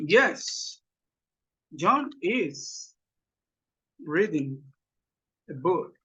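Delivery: slow dictation with long pauses; word-by-word answer. Shown as a demonstration. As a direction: model the answer in small separate pieces. (respuesta dictada por partes)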